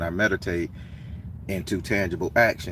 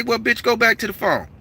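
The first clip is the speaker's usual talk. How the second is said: higher, retold scornful dismissal; dismissive snap; 13 semitones higher, 4 dB louder than usual. The Baron dismissing the stranger back to his phone, scornful. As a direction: send him away scornfully. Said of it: "I say".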